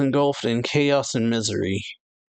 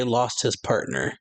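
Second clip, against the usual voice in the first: ordinary talk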